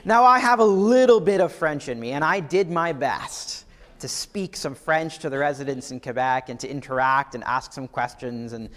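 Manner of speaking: ordinary talk, nothing marked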